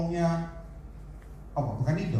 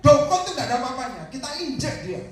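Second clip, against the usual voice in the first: fast, loud and high; rally the crowd against shamans; fired-up preaching; 7 semitones higher, 4 dB louder than usual. Acted. Preacher firing up the congregation, fast and loud.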